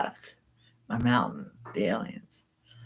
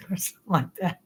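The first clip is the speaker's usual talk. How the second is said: ordinary talk, nothing marked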